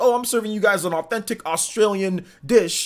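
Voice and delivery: light mock Australian accent on a cook's line; Australian accent attempt. Streamer imitating a Chopped cook, half-hearted Australian accent. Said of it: "I can't do an Australian accent very good".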